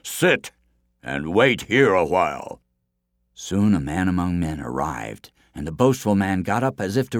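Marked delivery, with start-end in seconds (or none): 0.0-2.6 forest giant's firm order; commanding giant. A narrator's reading. Giant tells the runner to stay, commanding giant voice.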